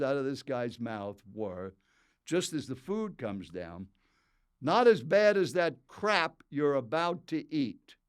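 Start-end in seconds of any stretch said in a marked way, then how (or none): 4.6-8.0 louder, slow and deliberate put-down; loud disdainful jab, words spaced out; blunt, scornful tone. Retold or imitated. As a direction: hit it hard and slow, like a rude stranger. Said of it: "Just in that tone of voice".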